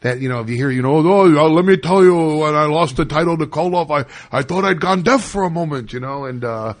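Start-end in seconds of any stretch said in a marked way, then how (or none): 1.0-5.9 put-on Sammartino in a Yiddish accent, raised.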